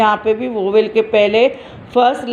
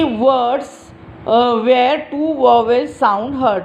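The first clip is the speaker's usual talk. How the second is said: very slow, careful English phrase; slow explaining; much slower than usual. Shown as a demonstration. Teacher explaining to small children, very slow. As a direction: stretch out each word so children follow. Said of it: "समझ आए ऐसी भाषा में".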